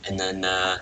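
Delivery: ordinary talk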